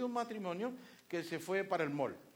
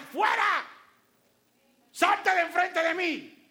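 loud, high, slow rebuke; preacher shouting at the devil; 13 semitones higher, 12 dB louder, much slower than usual. Acted. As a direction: command Satan to leave.

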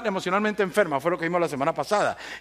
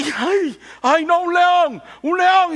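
high, loud panicked yelp at the lion; panicked cry; 11 semitones higher, 6 dB louder, much slower than usual. Retold or imitated. A man in a gorilla suit faces the lion, panicked high yelp. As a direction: shriek in terror at the lion.

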